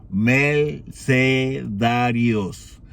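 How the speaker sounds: mercedarios drawn out very slowly, every syllable clear; mercedarios, slow and deliberate; careful correction. Shown as a demonstration. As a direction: spell out mercedarios slowly so it isn't heard as mercenarios. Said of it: "no mercenarios".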